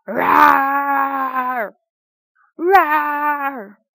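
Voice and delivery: loud roaring monster shout. A narrator's reading. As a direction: bellow it like a monster. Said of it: "jumped up and down and yelled".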